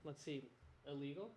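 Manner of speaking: very soft under-the-breath reading; muttering to himself. Real, not acted. Host checking search results, quiet muttering to himself. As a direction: murmur it while scanning a page.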